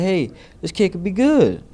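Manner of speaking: high, loud retold coach calling out to the gym; excited coach's call. Coach looks round the gym at a new boy, excited call. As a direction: imitate a coach proudly announcing a new find.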